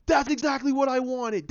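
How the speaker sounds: high, loud, delighted yes yes; squealing fanboy glee; thrilled cheer. Retold or imitated. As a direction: cheer like your fan theory just came true. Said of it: "I went".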